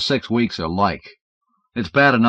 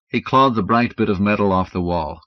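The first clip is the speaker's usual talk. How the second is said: ordinary delivery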